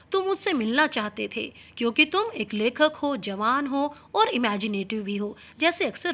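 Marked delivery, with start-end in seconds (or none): none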